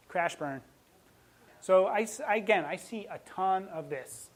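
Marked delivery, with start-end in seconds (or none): none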